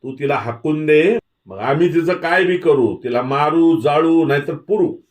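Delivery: high, loud angry tirade; screeched outburst. A narrator's reading. Avdi's in-law screaming at Dhanaji to stay out of it, high loud outburst. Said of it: "आवडीचा धीर किंचाळला".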